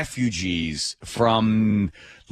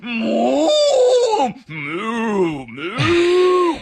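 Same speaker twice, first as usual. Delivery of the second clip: high, insistent cow moos; loud mooing; 12 semitones higher, 4 dB louder, much slower than usual. Retold or imitated. Passenger answering the yelling agent, mooing loudly. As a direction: moo back louder and higher each time. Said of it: "So I just started mooing a lot, really loud".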